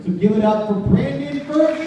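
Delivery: big rising shout to the crowd; hype intro. Real, not acted. Emcee introducing the guest to the audience, loud hype shout. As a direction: pump up the room for his name.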